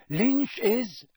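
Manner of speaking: high, excited yell; Harry yelling. A narrator's reading. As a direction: yell a quick correction over the crowd. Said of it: "yelled Harry".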